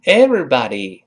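American "everybody" with open ah in body; American pronunciation. Shown as a demonstration. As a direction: say "everybody" the American way, unrounded lips. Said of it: "那美音就撅". (美式发音“everybody”，body发“啊”)

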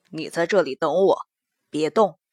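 low, firm command; low man's voice. Acted. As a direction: order him to stay, low. Mo Jie gives order.

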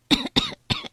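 high choking cough; smoke inhalation. A narrator's reading. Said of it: "卡文被烟呛得直咳嗽".

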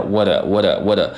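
"what" as a light "whadda" with a flapped t; repeated flap demo on "what"; tongue-tip flap. Shown as a demonstration. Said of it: "而是一个舌尖的".

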